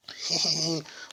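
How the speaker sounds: high cackling laugh; witch cackle. Acted. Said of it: "One of the witch cackled".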